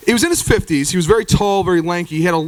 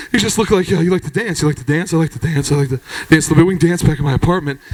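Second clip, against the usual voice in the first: low mumbled dance invitation; low mumbling; 5 semitones lower, faster than usual. Retold or imitated. Jeff inviting the comedian to dance, low mumble. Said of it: "He started mumbling".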